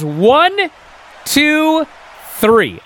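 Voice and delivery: ref's count. Retold or imitated. Ref counting the pin, a high call. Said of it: "the ref goes".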